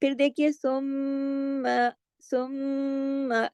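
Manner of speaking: सुम्मा with a long nasal doubled meem; full ghunna. Shown as a demonstration. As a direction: hold the meem of सुम्मा in the nose. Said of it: "ये जो बीच की आवाज है, ये गुन्ना है".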